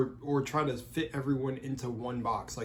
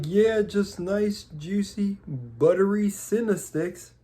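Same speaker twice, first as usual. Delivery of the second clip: food reviewer voice; 7 semitones higher, 6 dB louder, slower than usual. Retold or imitated. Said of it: "he's like".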